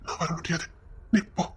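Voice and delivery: very soft, low undertone; hushed refusal. Acted. Telling the other to go alone, hushed. Secretly refusing to flee along.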